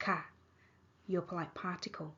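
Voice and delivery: ordinary talk, nothing marked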